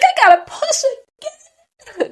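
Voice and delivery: very high, slow mock whine; retold dismay. Mother learning she must push again, mock dismay. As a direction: drag it out high in comic dismay.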